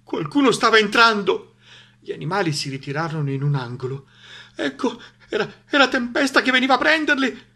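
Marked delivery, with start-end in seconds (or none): 0.0-1.5 high, loud suspense; alarmed narration. A narrator's reading. Narrator tells of someone entering, alarmed storytelling. 4.5-7.4 high, stammering start building to loud alarm; panicky narration. A narrator's reading. Animals think Tempesta is coming for them, panicky telling.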